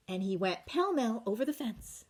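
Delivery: ordinary delivery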